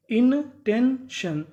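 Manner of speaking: 1 slow stretched word. Shown as a demonstration. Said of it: "teacher stretches word for students".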